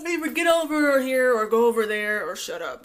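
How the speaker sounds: sigh, then vent your impatience; fed up. Retold or imitated. Venting at the boring couple, exasperated. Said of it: "'cause I'm like".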